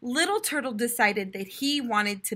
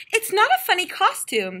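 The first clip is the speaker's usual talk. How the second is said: higher, a touch louder objection; protesting little turtle; 8 semitones higher, 4 dB louder than usual. A narrator's reading. Little turtle correcting his mother, higher protest. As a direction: insist like a hurt child. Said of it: "said Little Turtle".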